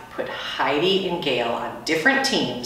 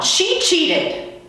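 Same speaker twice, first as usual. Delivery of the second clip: accusing; 13 semitones higher than usual. Acted. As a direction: point the finger at her. Girl accusing in a quarrel.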